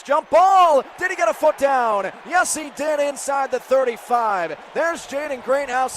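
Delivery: loud, high burst of play-by-play; urgent live football commentary; excited catch call. Real, not acted. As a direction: shout it as the ball hangs in the air.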